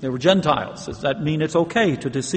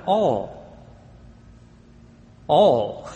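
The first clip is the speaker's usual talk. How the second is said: single drawn-out "all", set apart; emphatic, isolated "all"; stress on ALL. Shown as a demonstration. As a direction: let "all" stand alone with weight. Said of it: "I want to emphasize that word".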